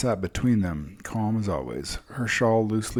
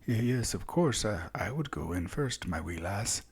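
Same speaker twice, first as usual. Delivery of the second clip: put-on nervous Southern accent, tripping over the start; Southern accent, quiet and unsure, offering to go first; accented stutter; 2 semitones higher, 4 dB quieter than usual. A narrator's reading. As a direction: stammer bravely in a soft Southern accent. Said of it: "heard his accent".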